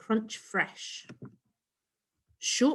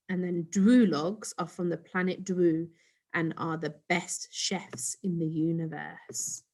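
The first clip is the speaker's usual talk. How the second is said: ordinary delivery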